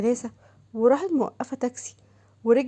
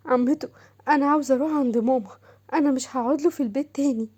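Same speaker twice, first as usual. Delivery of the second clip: put-on higher, pleading voice of an upset young woman; hurt young wife; 3 semitones higher than usual. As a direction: beg the aunt, close to tears. Narrator voices young wife Shams. (صوت زوجة شابة مجروحة تتوسل)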